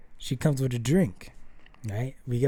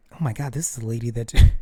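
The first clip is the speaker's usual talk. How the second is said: sudden louder, rushed oh-my-God blurt; panicked realization; 2 semitones lower, 7 dB louder, faster than usual. Retold or imitated. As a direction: blurt it fast as it dawns on you. Realizing it's the same lady.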